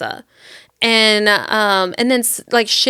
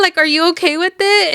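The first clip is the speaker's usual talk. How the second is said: mock flustered; 8 semitones higher than usual. Acted flustered request on phone.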